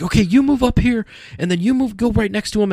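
high-pitched bossy mock yell; retold yelled orders. Photo staff position him beside Stan, mock yelled orders. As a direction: imitate staff ordering people into place. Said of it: "four more people in there yelling at me".

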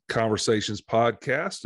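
usual speaking voice

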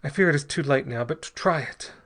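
high, halting desperate instructions; gasping. A narrator's reading. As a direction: force it out in broken, urgent bursts. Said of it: "gasped Ortiz".

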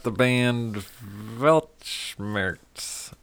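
big radio intro, stretched out on the band name; band announcer. Real, not acted. Host introducing the next band, announcer voice.

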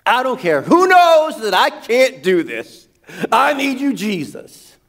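high, pleading cry to Jesus; desperate plea. Retold or imitated. Preacher voicing a desperate believer, pleading cry. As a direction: beg like someone at the end of their rope. Prayer cry voiced in sermon.

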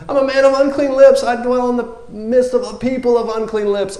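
high, loud anxious confession; a prophet flustered at his unworthiness; hammed-up high fretting; mock self-conscious panic. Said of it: "he's so self-conscious".

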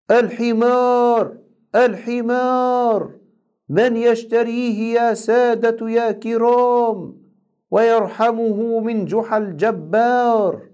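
high, slow drawn-out market cry; street vendor call. A narrator's reading. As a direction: call out the donkey for sale to the crowd. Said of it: "وهناك أخذ ينادي".

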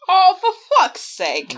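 loud exasperated groan. Real, not acted. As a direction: throw up your hands in frustration. Reacting to low roll.

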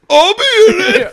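sudden high, very loud shout; hammed-up wrestler bellow; John Cena. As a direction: burst in yelling like an overeager wrestler. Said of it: "And Cena's like".

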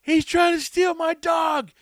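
high, loud, slow indignant yell; retold shout. Wheelchair lady yelling at Brandon about her dog, high slow shout. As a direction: cry it out like she's being robbed. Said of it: "she's like".

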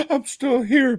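high silly parody; mock high echo. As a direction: repeat it way up high to tease.

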